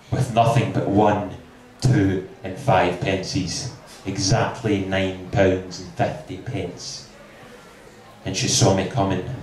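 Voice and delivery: ordinary talk, nothing marked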